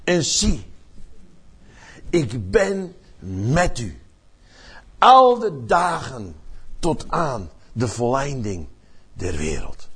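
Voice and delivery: slow, weighty words with long pauses; solemn scripture reading. Acted. The preacher reads Jesus's last words to the congregation, slow and solemn. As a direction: read it as a solemn promise. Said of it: "Dan zegt Jezus dit".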